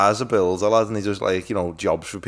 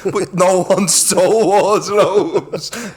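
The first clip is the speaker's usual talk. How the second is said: laughing; 7 semitones higher, 5 dB louder, much slower than usual. Real, not acted. Laughing retelling.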